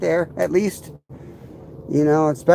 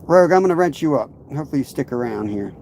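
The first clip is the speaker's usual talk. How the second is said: ordinary delivery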